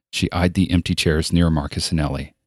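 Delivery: ordinary delivery, nothing marked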